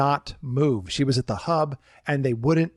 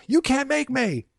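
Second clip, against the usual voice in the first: much higher, slow petulant whine; defiant guest; 10 semitones higher than usual. Retold or imitated. Guest refusing security guard, high slow whine. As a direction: drag it out like a stubborn child.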